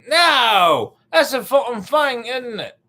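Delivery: high, shouted mock British accent. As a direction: insist loudly in hammed-up British. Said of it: "if your accent".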